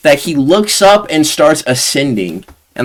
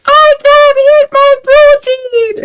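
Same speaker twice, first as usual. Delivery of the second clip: put-on much higher, slow Jamaican accent, loud; Jamaican meme kid; 5 dB louder, slower than usual. Retelling a meme to viewers, high Jamaican accent squeal. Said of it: "the funniest Jamaican meme".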